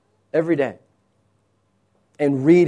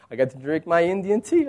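ordinary delivery

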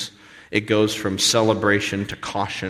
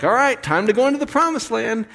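mock announcement; 12 semitones higher, 5 dB louder, faster than usual. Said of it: "God's like".

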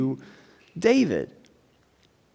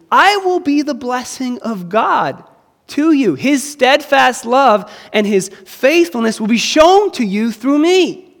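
bold declaration; 8 semitones higher, 7 dB louder than usual. Acted. Preacher voicing David's bold claim.

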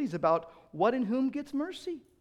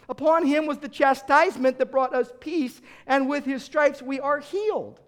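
full-force preaching; 9 semitones higher, 9 dB louder than usual. Acted. Preacher proclaiming Christ punished in her place, loud and soaring. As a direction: preach it out to the back pew.

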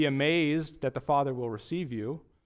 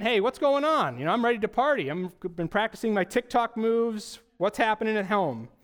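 eager party-ready brother; 5 semitones higher than usual. Pretend. Older brother asking the servant, eager and upbeat. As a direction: bounce in like a guy ready to dance.

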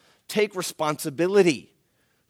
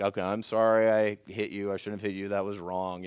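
put-on 1 grudging child apology; 7 semitones lower, 4 dB quieter than usual. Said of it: "lead them through those".

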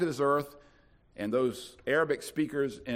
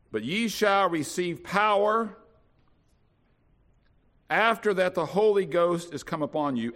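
scripture reading; 2 semitones higher, 3 dB louder than usual. Acted. Preacher to congregation, solemn scripture reading. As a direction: read the verse with weight. Reads scripture aloud in KJV.

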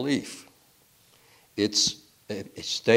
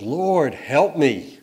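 higher, louder begging call; pleading cry; 6 semitones higher, 7 dB louder, much slower than usual. Acted. Man on branch begging the Lord, loud high plea. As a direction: beg for rescue.

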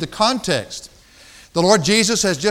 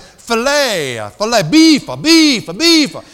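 higher, loud one-word ordering; loud broken tourist speech; 4 semitones higher, 7 dB louder, slower than usual. Retold or imitated. To the waiter, ordering beef in loud halting words. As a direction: spell it out loudly for a foreigner. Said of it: "I said".

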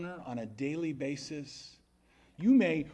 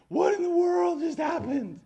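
high, loud panicked cry; shocked panic; 10 dB louder than usual. Retold or imitated. A student panicking over the sunken axe head, shrill shocked cry. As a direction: yelp it like you just lost your keys.

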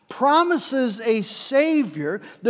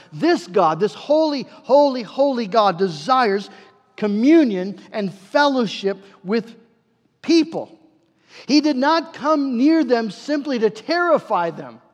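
louder, impassioned sermon climax; fervent preaching; 4 semitones higher, 4 dB louder than usual. Acted. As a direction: preach it with rising passion. Preaching builds to full force.

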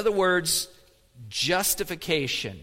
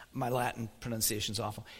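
normal talking voice

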